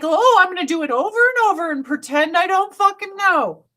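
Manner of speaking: high, exaggerated imitation of feigned innocence; mock innocent pretending. Mocking the worker who pretends not to know, high fake innocence. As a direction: mockingly voice someone playing dumb. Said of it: "So he's like".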